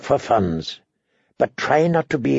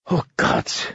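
unvoiced, strangled exclamation; stifled cry under the breath; holding back a scream; 4 dB quieter than usual. A narrator's reading. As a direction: gasp it out instead of screaming. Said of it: "all he could do not to scream".

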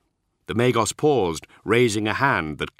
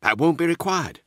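quickly; 10 semitones higher than usual. Acted. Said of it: "he said quickly".

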